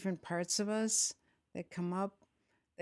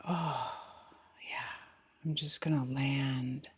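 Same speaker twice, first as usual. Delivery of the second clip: guided meditation; 2 semitones lower, 5 dB louder than usual. Acted. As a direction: guide them gently into the body, unhurried, falling phrase ends.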